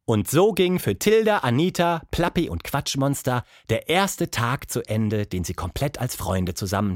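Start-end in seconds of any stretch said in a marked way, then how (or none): none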